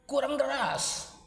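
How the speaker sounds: high, slow drawn-out command; bossy complaint. A narrator's reading. Jelitang demanding harder fanning, high drawn-out order.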